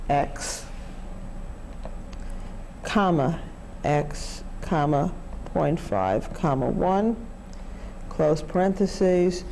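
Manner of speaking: slow keystroke-by-keystroke readout; dictating. Shown as a demonstration. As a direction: spell out each calculator entry. Reading calculator input aloud.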